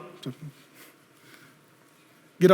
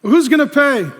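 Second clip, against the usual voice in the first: challenging; 7 semitones higher, 7 dB louder than usual. Acted. Challenge in call and response.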